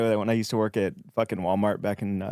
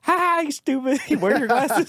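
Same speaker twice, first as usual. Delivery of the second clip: very high-pitched mock jeer; mocking mom imitation; 3 dB louder than usual. Mom teasing daughter about her glasses, mocking high voice.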